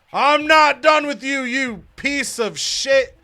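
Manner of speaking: high, loud yell, slowing at the end; angry shout. Retold or imitated. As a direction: bellow it at a rival. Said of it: "And Luke Gallows says".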